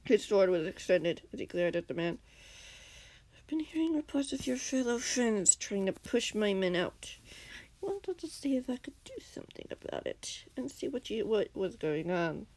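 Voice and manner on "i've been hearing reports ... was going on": softer, drawn-out villain voice; quiet, measured menace from a put-on voice; slow villain. A narrator's reading. Villain explains his arrival to enemies, slowly. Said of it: "the man said slowly".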